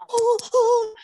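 high mock frightened "oh"; imitated startled, high-pitched gasps. Elderly lady being pushed in the street, mock frightened gasps. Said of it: "you could just see the lady's face".

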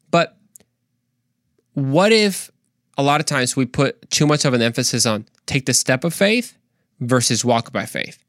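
normal talking voice

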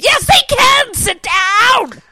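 high, loud shout at the TV; hammed-up sports-fan holler; retold yelling. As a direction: yell back in full voice, acting it out. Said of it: "why are you yelling at the T V".

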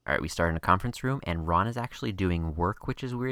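rapid-fire rundown, racing through; speed-talking a plot summary; talking really fast. Real, not acted. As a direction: rush it like you're against the clock. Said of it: "I'm gonna talk really fast".

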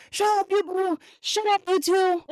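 mom in the back seat yelling at the couple. Retold or imitated. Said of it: "Mom's in the background being like".

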